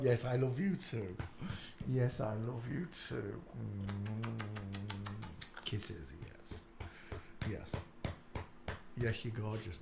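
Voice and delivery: soft loving words to a dog; tender. Real, not acted. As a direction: coo gently. Cooing to the dog.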